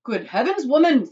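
loud, high exasperated outburst; exasperated protest. Acted. Husband rebukes panicking wife, exasperated protest. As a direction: protest loudly at the very idea. Father refuses to dive in.

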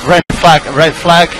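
loud urgent call over radio; urgent shout. Real, not acted. Engineer calls red flag urgently.

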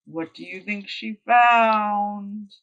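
ordinary delivery

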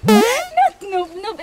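"Not ngubngub" slow, NOT stressed and high. Shown as a demonstration. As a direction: lean hard on "Not". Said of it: "it's N G".

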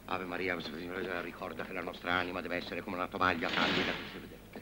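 soft, low priestly murmur; quiet prayer. Acted. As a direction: hush into prayer. Priest begins confession prayer.

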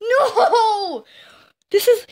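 high-pitched wailing shout; shrieked gamer disbelief; outraged disbelief. Real, not acted. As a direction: cry out in high, drawn-out protest. Shouted disbelief at the game's turn.

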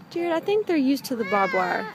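ordinary talk

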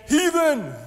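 loud, high mock condemnation; sudden high-pitched accusation; accusing shout. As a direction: cry it out like a scandalized churchgoer. Churchgoer denouncing someone asking questions.

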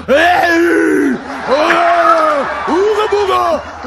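mocking crude catcalling; mock caveman catcall. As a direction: bray it like a clumsy caveman. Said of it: "I'm not talking about".

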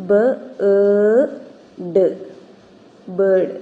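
ബ, ഏ, ഡ് spaced, then a loud ബേർഡ്; teacher blending sounds; sounding out. Shown as a demonstration. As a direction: split into sounds then say the word. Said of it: "ഇങ്ങനെയാണ് phonetic transcription".

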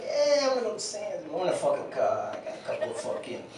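mock gruff dad voice, mumbled; dad impression. Comic telling his crowd how dad talks, mild mock voice. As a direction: lightly do your dad's way of talking. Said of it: "my dad talks nothing like that. It just sounds funny".

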